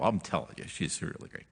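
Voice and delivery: breathy whisper, almost no voice; whispered aside. Real, not acted. Preacher whispers a fond aside to the congregation. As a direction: lean in and whisper it.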